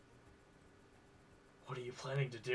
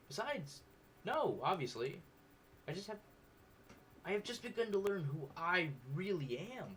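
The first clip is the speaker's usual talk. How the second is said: put-on higher-pitched young woman; Miriam voice; 7 semitones higher than usual. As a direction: speak in a raised, earnest voice.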